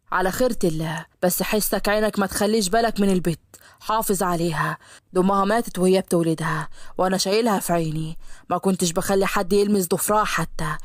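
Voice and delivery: low, stern but loving father; protective father. Acted. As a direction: hand over a daughter with a warning. Father entrusts daughter to groom.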